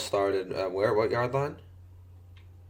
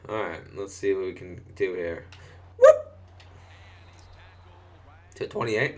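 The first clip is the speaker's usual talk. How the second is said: ordinary talk, nothing marked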